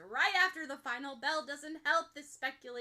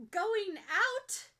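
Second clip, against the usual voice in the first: flustered question; 10 semitones higher, 9 dB louder than usual. Acted. Nervous girl blurts the rumour.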